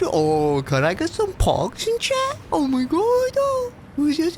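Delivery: put-on very high baby talk. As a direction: coo like a baby at chat. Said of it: "make [name] stop talking like a baby".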